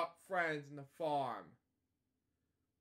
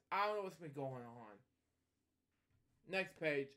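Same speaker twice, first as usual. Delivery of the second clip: ordinary delivery, nothing marked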